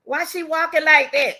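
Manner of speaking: retold alarm. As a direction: recall the shock of first seeing it. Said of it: "I said".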